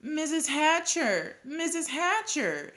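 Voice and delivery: high, drawn-out calls for attention; eager calling. A narrator's reading. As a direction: call out to a grown-up, high and slow. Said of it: "Sheila called".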